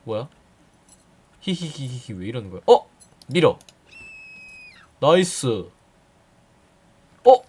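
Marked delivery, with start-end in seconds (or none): 1.4-2.1 breathy psycho giggle, falling; creepy giggle. Pretend. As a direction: giggle like a creepy old hag. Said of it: "나 너무 사이코 같잖아".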